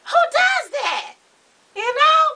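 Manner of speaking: high, incredulous shout, drawn out; exasperated outcry. Real, not acted. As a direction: cry it out in comic disbelief. Ranting about boiled cow hooves.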